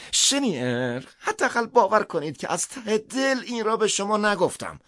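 high, loud pleading cry; desperate high plea, loud. Acted. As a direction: cry out begging to be believed.

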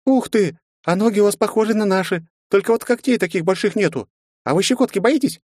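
put-on high, eager curious child; bubbly girl full of questions; little girl voice.

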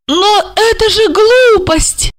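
high, loud, slow outraged cry; shouting. A narrator's reading. Olga shouts at Marina's forgery, high slow outrage. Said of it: "закричала она".

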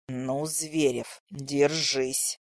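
low looming threat; slow, low and quiet hiss of menace; through gritted teeth. A narrator's reading. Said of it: "процедил он сквозь зубы".

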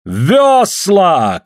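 high, loud shout. Acted. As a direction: bark the command. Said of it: "кричит Суль".

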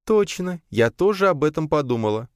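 high, bright exclamation; excited exclaim. A narrator's reading. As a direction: exclaim it eagerly. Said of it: "воскликнула его жена". (воскликнула радостно)

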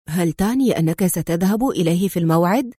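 ordinary delivery

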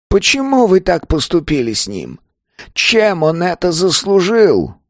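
put-on high, desperate elderly voice; an old man begging for an answer; pleading old man. A narrator's reading. (отчаянный голос старика)

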